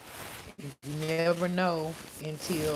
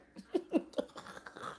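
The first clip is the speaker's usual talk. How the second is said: whispered laugh; 12 semitones higher, 8 dB quieter than usual. Real, not acted. Whispered laugh after quote.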